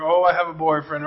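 low, lightly acted lovestruck swoon; mock love glow. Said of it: "they have the glow about".

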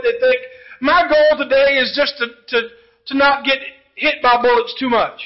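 loud, driving preaching; impassioned sermon build; preaching at full force. Acted. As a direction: press the point home to the pews. Preacher builds soldier illustration.

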